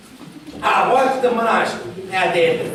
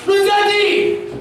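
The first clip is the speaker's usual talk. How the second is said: calling out; 6 semitones higher, much slower than usual. Acted. Calling out to someone.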